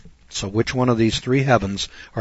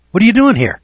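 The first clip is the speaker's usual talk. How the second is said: loud, high-pitched mock outburst; berating; 10 semitones higher, 7 dB louder, faster than usual. Judge berating an unprepared lawyer in court, loudly exasperated. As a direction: imitate an indignant judge yelling.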